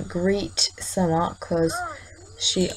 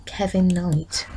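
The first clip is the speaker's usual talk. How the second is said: ordinary talk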